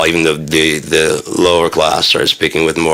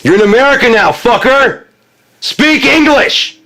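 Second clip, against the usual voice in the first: much higher, louder mock shouting; angry American yell; 4 dB louder than usual. Mocking an immigrant, a hammed-up furious shout. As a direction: bark it like a red-faced patriot.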